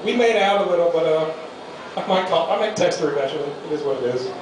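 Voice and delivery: normal talking voice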